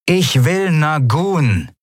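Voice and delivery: slow, high captain over a speaker; drawn-out order through the intercom; crackly loudspeaker voice. A narrator's reading. Captain calling over the loudspeaker, crackly intercom voice. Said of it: "rauschte eine vertraute Stimme aus einem Lautsprecher".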